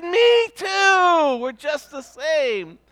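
very high, put-on voice, slowing down at the end; gleeful character voice. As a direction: squeal it like a delighted kindred spirit. Man delighted at shared identity.

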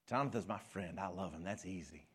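normal talking voice

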